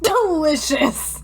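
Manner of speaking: slow, savoring "Delicious!"; drawn-out mock delight. Friend echoes with slow, mock savoring delight. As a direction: relish it in jest.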